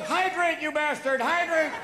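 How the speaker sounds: high, exasperated command; urgent mock order. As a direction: urge him loudly, as a parody aide. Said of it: "have people go".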